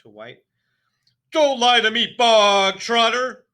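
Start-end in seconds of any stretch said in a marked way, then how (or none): 1.3-3.4 high, very loud, slow bark; furious headmistress. A narrator's reading. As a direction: bark the warning. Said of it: "barked the Trunchbull".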